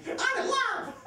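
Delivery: very high, gushing lover imitation; high-pitched, hammed-up devotion; mock lovestruck. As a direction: sing out a silly lovestruck answer. Mocks people married to losers.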